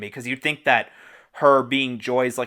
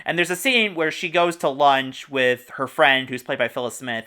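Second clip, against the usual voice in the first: ordinary delivery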